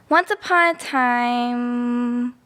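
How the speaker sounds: drawn-out once upon a time; slow storybook opening. Acted. Child starting a bedtime story for Teddy, slow and drawn out. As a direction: stretch each word like a grand opening. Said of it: "slow dramatic story opening".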